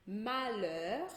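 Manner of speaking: "Malheur" stretched, plain L and silent H; LH como L. Shown as a demonstration. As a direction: say "Malheur" slowly in French, no LH sound. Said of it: "eu pronuncio o L". ("Malheur" em francês, devagar, só o L)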